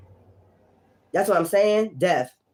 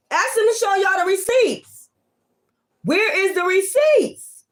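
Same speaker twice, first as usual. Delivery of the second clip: challenging demand; 9 semitones higher than usual. Real, not acted. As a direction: stretch it out and dare them to show proof. Repeats the demand for proof.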